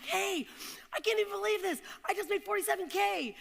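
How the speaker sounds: a wife telling her husband about her first big month; thrilled news. Retold or imitated. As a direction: bubble over with the news.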